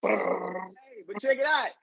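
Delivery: normal talking voice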